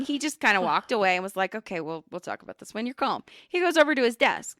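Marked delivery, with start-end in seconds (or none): 1.5-3.2 much softer, lower, defusing; calm backing off. Retold or imitated. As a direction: quietly step back from the fight. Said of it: "he did the right thing".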